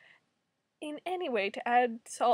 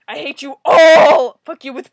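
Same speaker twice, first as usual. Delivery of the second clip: angry shout; 16 dB louder than usual. Real, not acted. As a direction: drag it out at full volume. Raging at the comic writers.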